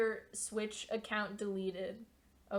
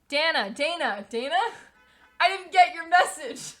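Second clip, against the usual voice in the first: calling out; 6 semitones higher, 9 dB louder than usual. Real, not acted. Calling out to a friend in chat.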